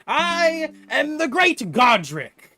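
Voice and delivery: pompous little inventor introducing himself; grand Godric. Acted. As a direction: boom out his own title like a showman. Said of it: "and he says".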